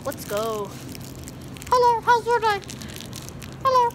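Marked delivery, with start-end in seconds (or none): 1.7-3.8 high Elmo squeak; chirpy puppet greeting. Pretend. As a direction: Elmo impression greeting a worker. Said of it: "Do I sound like Elmo?".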